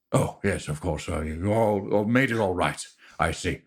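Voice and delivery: lightly voiced nervous, flustered man; a touch of jittery politeness, stumbling over words; [name]. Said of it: "Carter says".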